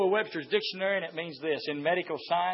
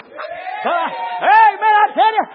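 high, loud shouted preaching; full-force preaching; 13 semitones higher, 12 dB louder than usual. Acted. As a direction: preach it hard, raising the roof.

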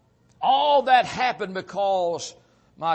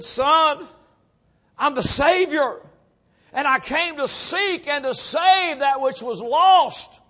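higher, louder, slow proclamation; voice of the Savior; 8 semitones higher, 6 dB louder, slower than usual. Retold or imitated. The Savior calls the lost man, slow ringing proclamation. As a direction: announce salvation like a preacher at full force. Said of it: "that still small voice spoke again".

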